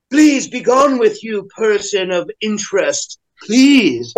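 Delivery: slow, stretched-out mock plea; mock haughty dismissal. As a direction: dismiss them as a precious hipster would. Still mocking the self-important hipsters.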